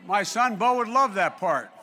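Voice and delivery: normal talking voice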